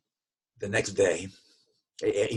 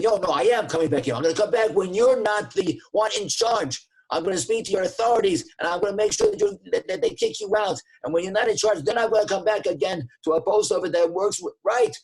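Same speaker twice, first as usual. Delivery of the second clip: much higher and louder, a raging rant; furious threats; 9 semitones higher, 6 dB louder than usual. Retold or imitated. Customer threatening the clerk before storming out, furious. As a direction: threaten to get him fired. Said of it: "And he storms out of there".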